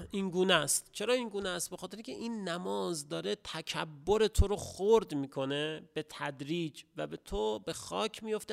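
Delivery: ordinary talk, nothing marked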